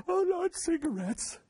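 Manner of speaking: very high hammed-up squeal of alarm; mock horror. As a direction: pretend to be scandalized, in falsetto. Mock horror at tobacco rule.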